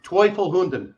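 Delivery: Teufelhunden as "TOY-fel-hoon-den" with no R; corrected German. Shown as a demonstration.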